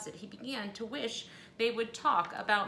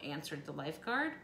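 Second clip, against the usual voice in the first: usual speaking voice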